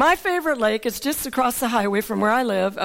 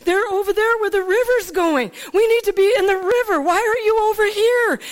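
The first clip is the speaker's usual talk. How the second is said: urgent call; 8 semitones higher than usual. Retold or imitated. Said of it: "and I'd go".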